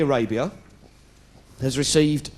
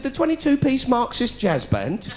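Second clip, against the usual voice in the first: incredulous; 9 semitones higher than usual. Acted. Incredulous follow-up question.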